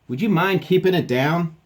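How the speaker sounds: high, loud complaint; acted annoyance. Captain scolding the squeaking dog, loud exasperation.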